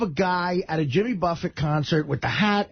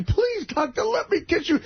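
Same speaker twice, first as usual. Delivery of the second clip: begging lady; 10 semitones higher than usual. Retold or imitated. Lady pleading higher.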